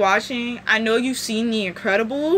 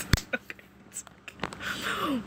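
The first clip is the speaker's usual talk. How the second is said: whispered; 12 dB quieter than usual. Real, not acted. Consoling herself after a comment.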